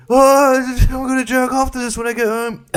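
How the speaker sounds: put-on high, strained voice of an aroused boy; mock ejaculating ten-year-old. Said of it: "That's the sound of me ejaculating when I was ten".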